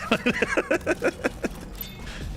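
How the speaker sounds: airy, high-pitched giggle; breathy squeak of a laugh. Real, not acted. Streamer flustered in front of chat, high breathy giggle.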